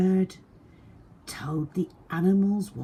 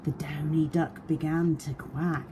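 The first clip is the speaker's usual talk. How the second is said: ordinary delivery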